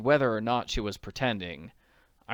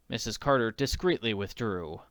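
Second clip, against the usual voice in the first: ordinary delivery, nothing marked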